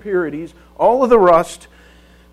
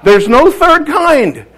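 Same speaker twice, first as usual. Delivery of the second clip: exasperated announcement; 3 semitones higher, 7 dB louder than usual. Retold or imitated. Father announces to wife after four children, mock exasperation. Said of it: "I announced to Joan".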